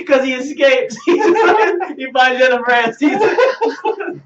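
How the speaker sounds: high, loud, helpless laughter through the words; laughing while talking. Real, not acted. Improvising a Con Air sequel for co-hosts, cracking up. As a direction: crack up while pushing the story out.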